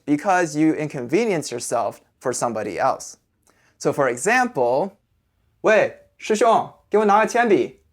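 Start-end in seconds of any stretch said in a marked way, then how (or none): 5.3-7.8 higher, louder acted call for attention. Student asking a senior classmate for a pencil, calling out. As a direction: call over to a classmate for a favour.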